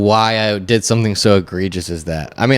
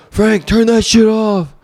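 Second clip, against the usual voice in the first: high, drawn-out mock holler; raised voice, stretched-out complaint; mock yelling; 11 semitones higher, slower than usual. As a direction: holler irritably at Frank, half in jest. Imagined yelling at Frank about noise.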